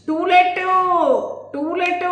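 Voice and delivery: high, loud shout over the radio noise; shouting at the landlord over the blaring radio; shouting as loud as possible. Acted. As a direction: yell it so he hears over the music. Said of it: "సాధ్యమైనంత గట్టిగా అన్నాను".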